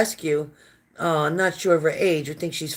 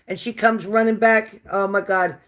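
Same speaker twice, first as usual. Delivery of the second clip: normal talking voice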